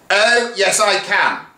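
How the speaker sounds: high, defiant retort; thundering. Acted. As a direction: thunder it back at the secretary. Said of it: "thundered the deputy head".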